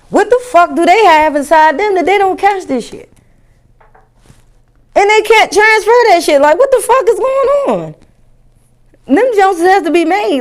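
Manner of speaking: high excited rant; rant, voice climbing; worked up. Real, not acted. Ranting about mosquitoes.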